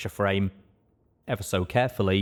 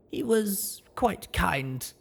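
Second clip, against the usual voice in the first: higher, slow fond servant voice; fond maid voice; 11 semitones higher, much slower than usual. Acted. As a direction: linger on the memory fondly. Maid fondly remembers.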